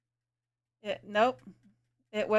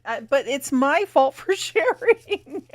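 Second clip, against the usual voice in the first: laughing; 7 semitones higher, 7 dB louder, slower than usual. Real, not acted. Laughing at own fault.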